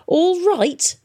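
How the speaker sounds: high loud indignant yell of "All right?"; shouted. A narrator's reading. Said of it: "shouted Tom".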